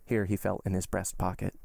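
ordinary delivery

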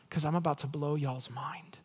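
hushed whisper of a secret. Acted. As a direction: lean in and whisper it. Jesus voiced, secret aside.

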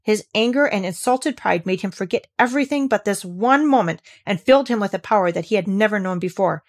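ordinary delivery

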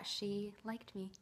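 ordinary talk, nothing marked

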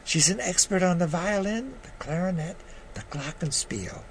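measured storytelling read with voiced quotes; memoir reading. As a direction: read your story to the audience. Reads his memoir piece aloud.

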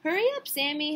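calling out. A narrator's reading. Mother to Sammy before his first school day, calling out. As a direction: call to hurry a child along. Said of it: "his mother called".

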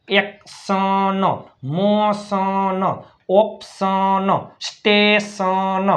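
each word drawn out slowly for learners; slow spelling drill. Shown as a demonstration. Teacher slowly sounding out words for students. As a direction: stretch every syllable so students follow. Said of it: "જો, ન એ".

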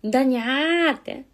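loud, drawn-out Yamagata "nyaa"; meowing local Yamagata dialect; cat-like Yamagata dialect. Shown as a demonstration. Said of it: "猫語？".

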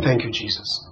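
barely voiced, low; retold tearful thanks. Pastor echoes his mentee's words of thanks, very softly. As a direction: imitate a bereaved woman's hushed thanks. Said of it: "She was crying".

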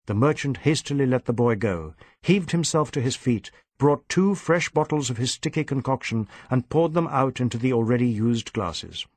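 plain reading voice

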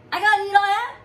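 high, loud disbelieving cry; can't believe what he's hearing; boy yelling in disbelief; shocked yell. Acted. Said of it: "yelled the Mango Boy".

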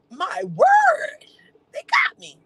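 high, loud gasp of mock shock; hammed-up horror at being caught; mock shocked gasp and cry. Retelling being doxed.

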